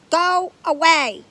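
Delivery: big, slow booming actor's voice. A narrator's reading. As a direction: boom it out from the couch. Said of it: "said a booming voice".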